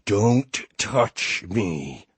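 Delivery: very slow, possessed demon growl. Acted. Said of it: "she growled, in the best impression ... demon from The Exorcist".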